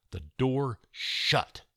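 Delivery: plain reading voice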